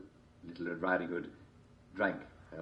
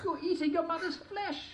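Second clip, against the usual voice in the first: tiny high mouse. A narrator's reading. Mouse voiced.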